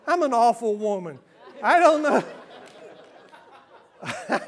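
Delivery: chuckling at her own joke; self-mocking laugh. Real, not acted.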